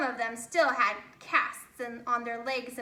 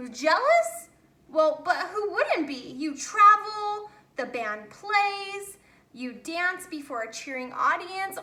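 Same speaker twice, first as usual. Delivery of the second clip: animated protest; 5 semitones higher, 6 dB louder than usual. Acted. Max protests jealousy, animated.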